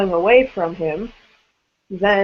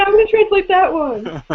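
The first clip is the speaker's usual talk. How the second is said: lightly teasing higher-pitched quote; mock eager translator; 10 semitones higher than usual. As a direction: tease him in a raised voice. Jokingly voicing another member's plan.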